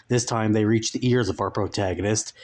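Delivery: usual speaking voice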